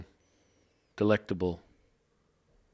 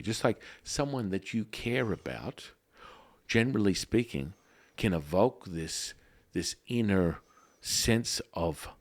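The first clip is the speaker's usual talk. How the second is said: usual speaking voice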